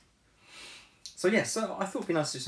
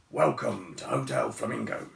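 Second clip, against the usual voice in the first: deep bear voice trying to sound cheerful; low old bear forcing a welcome; forced cheer; 10 semitones lower than usual. A narrator's reading. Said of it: "trying to sound cheerful".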